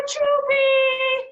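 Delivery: triumphant loud call. Acted. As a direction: shout it out triumphantly. Calling feeding moment.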